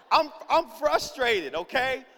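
slow, high, loud venting; worked-up mock tantrum; frustrated. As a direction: stretch it out in exasperation. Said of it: "I'm getting frustrated".

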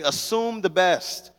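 slow, deliberate "Assume the best."; slow call phrase. Shown as a demonstration. Preacher leading the congregation in a slow "Assume the best.". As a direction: lay out "Assume the best." slowly for the crowd to repeat. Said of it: "Look at your neighbor and say".

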